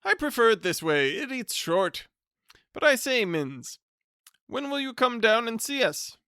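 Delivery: loud, high hearty greeting; top of his voice. Acted. Hearty cousin greeting the bachelor at the top of his voice. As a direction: boom it out like a jovial bore. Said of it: "spoke at the top of his voice".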